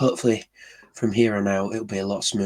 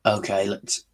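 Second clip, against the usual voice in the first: normal talking voice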